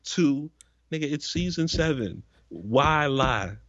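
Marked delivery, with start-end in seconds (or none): none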